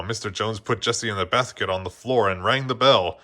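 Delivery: usual speaking voice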